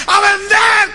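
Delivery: very high, loud crowd shout; full-volume answer. Retold or imitated. Staff proving they can be heard, full shout. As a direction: imitate staff screaming the slogan. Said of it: "No se oye".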